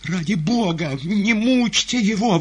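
high, loud, slow plea; woman's voice. A narrator's reading. Woman in a box begging for mercy, high plea. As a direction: implore over the din in a high voice. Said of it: "прозвучал из ложи женский голос".

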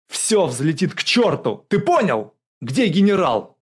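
loud, high, urgent demand; shouting. A narrator's reading. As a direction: yell at the officer for an answer. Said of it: "крикнул он". (кричать, требуя ответа)

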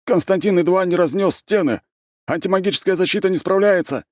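high, loud alarmed cry; panicked report. A narrator's reading. Guards report the rampage to their chiefs, panicked shouting.

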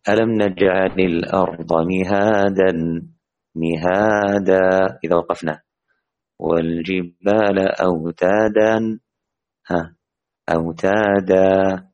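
slow recitation, each final alif held long; measured tajweed chant, drawing out the end of أوتادا; Quranic recitation with madd. Shown as a demonstration. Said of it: "هكذا".

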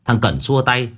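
ordinary delivery, nothing marked

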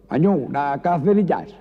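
pide alto y agudo otra vez; grito del maño. Acted. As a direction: grita la petición. El maño vuelve a gritar. (maño gritando en el estanco)

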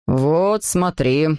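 low-voiced Monsieur Patmouille; deep, grown-man voice; father showing a friend. A narrator's reading. Host father shows the visiting dad the room, in a low voice. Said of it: "сказал месье Патмой папе".

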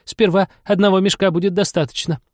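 lightly voiced rabbit. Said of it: "прозвучал голос кролика".

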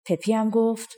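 usual speaking voice

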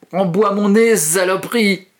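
high, loud furious cry; pained curse. Acted. Bleeding kid cursing the teeth, high pained shout. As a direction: spit it out in pain and rage.

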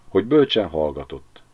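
plain reading voice

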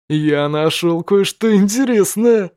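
slow menacing street thug. Acted. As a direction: drawl it like a gang punk. Thug discovers hero. (медленный голос бандита)

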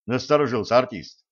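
ordinary delivery, nothing marked

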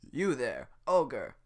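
guard confronting the ogre; guard calling out. Acted. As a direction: halt him with authority.

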